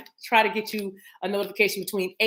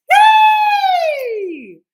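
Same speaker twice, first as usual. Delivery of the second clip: very high, loud, long squeal; squealed cheer; 12 dB louder than usual. Real, not acted. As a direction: shriek a drawn-out cheer. Loud celebration.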